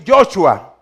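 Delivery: Joshua said as "YO-chua"; vowel variant. Shown as a demonstration. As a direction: put an "o" in the first syllable of Joshua. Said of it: "colocar las vocales para que suene".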